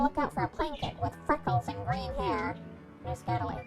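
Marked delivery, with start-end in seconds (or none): none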